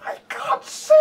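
put-on very high whispered child wail; a terrified kid, high and breathy; panicked child cry. Voicing blind child on box.

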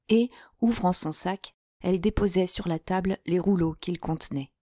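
ordinary delivery, nothing marked

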